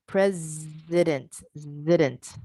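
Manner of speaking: slow "President, zident" with a long, buzzing Z; drawn-out Z. Shown as a demonstration. Teacher isolating the Z sound for trainees, drawn-out Z in "President, zident". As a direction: stretch the Z in the middle of "President, zident". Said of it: "That Z sound".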